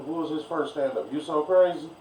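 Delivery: ordinary talk, nothing marked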